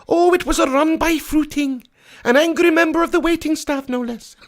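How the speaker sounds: put-on high, prim old nanny voice; Mrs Doubtfire. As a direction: gossip like a proper granny. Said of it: "Missus Doubtfire".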